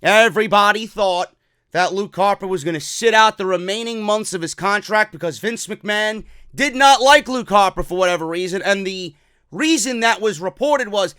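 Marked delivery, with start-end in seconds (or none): none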